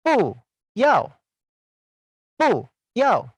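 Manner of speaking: "U, yao" both falling hard; fourth-tone bu. Shown as a demonstration. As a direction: drop sharply on "U" and "yao". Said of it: "seperti orang yang sedang marah".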